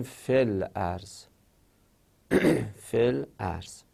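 فل ارض as "fel arz", fi shortened into the l; article's hamza dropped. Shown as a demonstration. Teacher demonstrating elision, فل ارض said as "fel arz". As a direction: join fi to the l, then sound the hamza of ارض.

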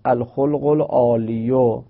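العالیو with a final "-yu"; hidden damma voiced. Shown as a demonstration. Teacher explains the footnote example, damma sounded on العالیو.

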